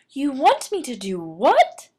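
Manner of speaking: shocked high outburst; can't believe what she's hearing; aghast. Acted. Martina reacting to grandmother's shocking advice, aghast.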